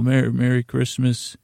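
ordinary talk, nothing marked

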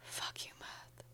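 whispered curse. Acted. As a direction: hiss it under breath. Cursing the defeated spirit.

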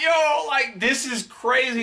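high-pitched retold amazement; amazed reaction. Telling friends about a video of kids rapping, amazed. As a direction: exclaim it high, like you can't believe it.

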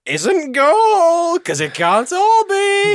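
very high, loud, slow holler; mock prospector. Gary the miner judging his find, hammed-up high holler. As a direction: stretch the end out as a hammed-up cry.

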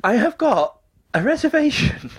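high, slow and deliberate; high-pitched mock restraint; strained patience. Strained pleading with new agent.

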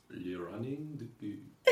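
slow, hammed-up mock French accent. Co-host joking how she says the film title in France, mock French accent. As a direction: ham up a fake French accent, slowly. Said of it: "as I say in France".

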